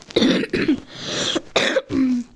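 drawn-out coughing fit; long sick cough. Real, not acted. Sick streamer coughing between games. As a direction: cough through it, still ill. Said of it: "Arkadaşlar ben hastayım da".

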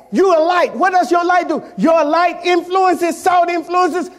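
rising preacher's shout, drawn out; full-force sermon cadence; preaching. Acted. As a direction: preach it loud and slow to the congregation.

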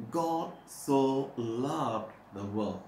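"God so loved the world" stretched out slowly, "God" stressed; slow emphasis. Shown as a demonstration. Preacher repeating the verse for emphasis. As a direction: dwell on each word of "God so loved the world". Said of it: "I always emphasize".

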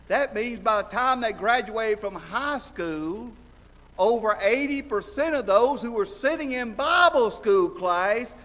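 loud, high, driving sermon delivery; preaching at full force. Acted. Preacher pressing the congregation, loud and high. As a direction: preach it hard to the pews.

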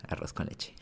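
whispered name, unvoiced and soft. Shown as a demonstration.

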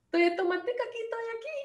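a cute tomato sitting in the salad, flirting; flirty tomato. Acted. Teacher voicing a flirty tomato sitting in the salad, high and coquettish. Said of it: "el tomatico sentado y coqueta así".

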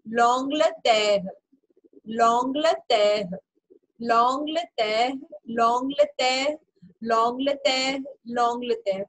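stretched, clearly separated syllables in "L'Angleterre"; French pronunciation drill. Shown as a demonstration. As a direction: slowly repeat "L'Angleterre" as long-gluh-tair. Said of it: "एक बार हम practice कर लेते हैं". ("L'Angleterre" को धीरे "लॉन्गलतेर" बोलकर दोहराएँ)

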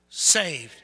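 loud, falling call-out of SAVED; real loud. Shown as a demonstration. Preacher leading congregation to say saved, loud. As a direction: call out SAVED for others to join. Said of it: "Let's say it real loud".

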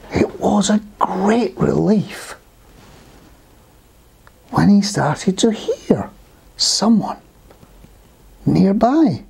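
slow, drawn-out storytelling; measured storybook voice with long pauses; story narration. A narrator's reading. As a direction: build suspense for young listeners, slowly. Reads a story to children.

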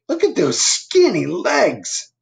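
high, slow sneering cat voice; a lightly voiced catty sneer; mocking cat. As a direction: tease him in a high, slow voice. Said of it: "said Cat".

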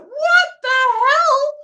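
a drawn-out shriek of disbelief; stunned disbelief. Retold or imitated. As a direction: blurt out pure astonishment. Shocked at friend's reply.

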